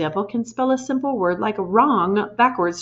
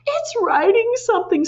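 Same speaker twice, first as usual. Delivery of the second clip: much higher, panicked scream; shrill scream; 3 dB louder than usual. A narrator's reading. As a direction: shriek at the board. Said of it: "screamed Nigel".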